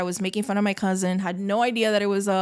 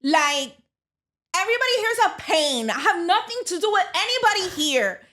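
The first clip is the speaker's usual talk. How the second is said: put-on Kendall girl voice; 8 semitones higher than usual. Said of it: "she's very like".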